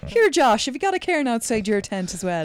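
high, loud hammed-up Irish accent; mock Irish accent. Teasing Josh about cairns, hammed-up Irish accent. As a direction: play a cheery Irish camper. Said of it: "in an Irish accent".

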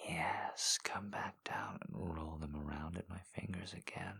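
hushed, intimate whisper. Acted. Intimate whispered roleplay throughout.

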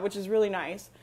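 usual speaking voice

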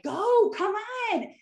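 high, slow, drawn-out mock holler; imitated impatient parent. Parent rushing kids out, imitated impatient holler. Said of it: "so true as a parent".

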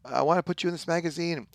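lightly voiced clamoring fans; eager callers. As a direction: pile on like pushy agents.

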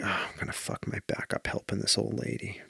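whispered inner thought; whispering. Retold or imitated. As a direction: hush it like a private worry.

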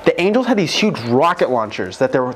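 ordinary talk, nothing marked

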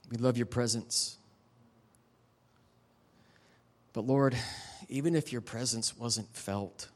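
closing prayer. Acted. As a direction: pray it over the room, unhurried. Pastor leads closing prayer.

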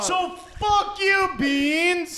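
high, loud outraged yell; heated shouting. Real, not acted. Heated [name] vs [name] argument.

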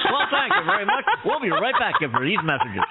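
radio host. Pretend. Parodies radio show host.

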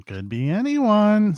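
put-on very high, slow old lady voice; high-pitched old woman, stretched out; second old lady. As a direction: echo it in a high, drawn-out crone voice. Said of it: "says the other one".

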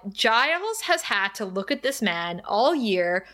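ordinary delivery

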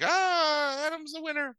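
very high, thin imitation cheer; mock crowd cheer. Everybody greets the race winner, mock cheering.

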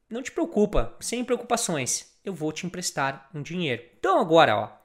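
usual speaking voice

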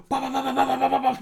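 high, loud rhythmic bah bah bah; taunting bah chant. Retold or imitated. Friend reenacting pounding the door, chanting loud and high. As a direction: hammer out the taunt syllables.

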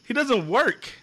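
high, loud, emphatic disbelief; worked-up and amazed, hitting each phrase; incredulous raving. Real, not acted. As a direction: deliver the scandalous reveal with force. Outrageous reveal about the show.

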